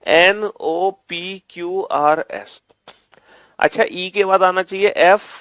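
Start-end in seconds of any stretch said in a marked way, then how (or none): 0.0-2.6 very slow, one letter at a time; slow letter-by-letter count. Shown as a demonstration. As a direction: spell it out slowly for the students. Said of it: "कैसे बना?".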